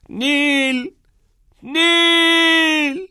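very high, loud, drawn-out scream of the name; heart-rending scream. A narrator's reading. Boy alone in the hall screaming his dead friend's name, heart-rending. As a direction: tear the name out of your chest. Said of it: "فریادی جگرخراش".

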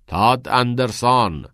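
slow, loud call down the hall. Acted. Teacher calling Todd from the end of the hallway, loud and slow. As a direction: summon him from afar, drawn out.

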